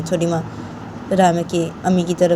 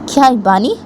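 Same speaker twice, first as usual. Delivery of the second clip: stepmother voice; 6 semitones higher, 4 dB louder than usual. Acted. Stepmother questions Bani.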